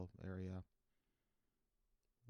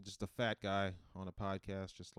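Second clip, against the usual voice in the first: ordinary talk, nothing marked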